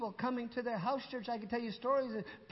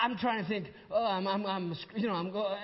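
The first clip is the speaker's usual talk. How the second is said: normal talking voice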